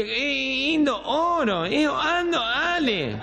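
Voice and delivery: high, slow nasal garble; gangoso. Acted. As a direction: drop the consonants and honk through the nose. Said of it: "medio gangoso era". (habla gangosa, aguda y arrastrada)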